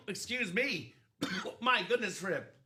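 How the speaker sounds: coughing, clearing the throat; recovering from a drink down the wrong pipe; choking on water. Real, not acted. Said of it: "Wrong pipe".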